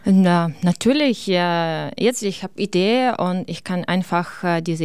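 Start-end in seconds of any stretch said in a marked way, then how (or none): none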